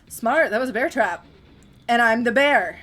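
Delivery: loud gloating threat; menacing killer voice. Acted. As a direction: boom it like a brute villain. Voicing Trapper's threat.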